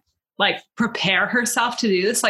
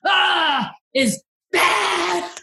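high mock outburst, words barely escaping; bursting with anger; 7 semitones higher, much slower than usual. Imagining the old lady confronting him, bursting with anger. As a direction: act so angry only one word gets out. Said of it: "she was bursting with anger".

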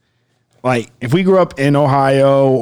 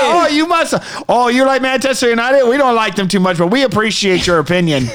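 high-starting, courteous Canadian caricature; politely disagree in a joke Canadian accent; mock polite Canadian; 5 semitones higher than usual. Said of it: "they're like".

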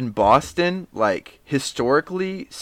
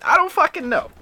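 much higher exasperated. Real, not acted. Friend giving up on naming Boston achievements, high exasperated. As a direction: pitch jumps way up in mock despair.

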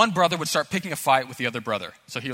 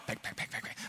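quick pecks under the breath; hushed mimicked knuckle jabs; imitating pecking; 14 dB quieter, faster than usual. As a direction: tap out the pecks, barely voiced. Pecking resumes on little brother.

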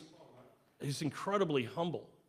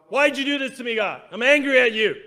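higher and louder, acting out a complaint; mock angry complaint; 6 semitones higher, 10 dB louder than usual. Preacher playing a person yelling at God, mock angry. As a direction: shout it at God like a bitter complainer. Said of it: "people blame God".

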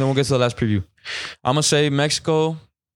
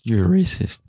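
voice cracking. Real, not acted. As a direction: voice cracks mid-word. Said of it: "Voice crack in the middle of calling".

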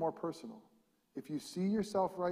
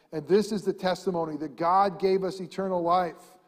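louder, higher, very slow; scripture reading; 4 semitones higher, 7 dB louder than usual. Acted. Pastor reading First John, slow solemn proclamation. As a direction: stretch each word out solemnly.

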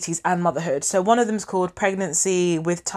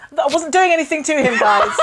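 laughing protest; 8 semitones higher, 6 dB louder than usual. Real, not acted.

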